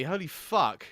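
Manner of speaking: ordinary delivery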